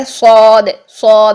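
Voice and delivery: صاد with a long aw vowel; full-mouthed saad. Shown as a demonstration. Teacher contrasting saad with seen, صاد thick with aw. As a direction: fill the mouth and stretch صاد. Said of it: "منہ بھر کے جب ادا کریں گے".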